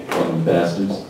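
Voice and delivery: ordinary talk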